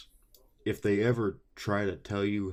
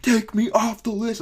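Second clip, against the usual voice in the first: high, loud demanding yell; mock her screeching demand; shrill angry woman; 7 dB louder than usual. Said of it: "she's like".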